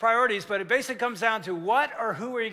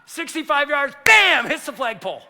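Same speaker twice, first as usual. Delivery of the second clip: much higher, much louder bam; excited bam; 5 semitones higher, 9 dB louder than usual. Real, not acted. Preacher reliving the kick, excited shout. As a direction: shout the impact.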